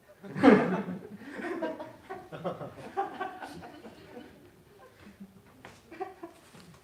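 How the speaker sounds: big burst of laughter; loud high laugh. Real, not acted. Laughing after doors joke.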